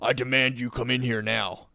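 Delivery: a hint of a stiff, commanding official; lightly lampoon an overbearing staffer ordering a kid; imitating a counselor barking orders at a student; mock bossy authority figure. Mocking a bossy school counselor.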